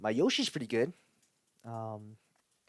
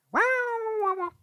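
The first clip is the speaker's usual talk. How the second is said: squeaky Yoshi wows; 7 dB louder than usual. Retold or imitated. Yoshi sounds.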